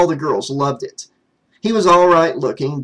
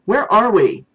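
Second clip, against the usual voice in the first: worried; 7 semitones higher, 4 dB louder than usual. Acted. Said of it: "her eyes wide with concern".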